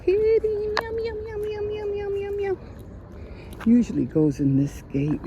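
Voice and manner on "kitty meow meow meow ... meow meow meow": high sing-song meows to coax a cat; meowing to a cat. Real, not acted. Vlogger calling a stray cat, squeaky high meowing.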